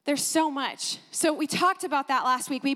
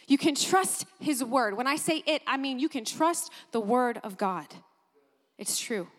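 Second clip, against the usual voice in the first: ordinary delivery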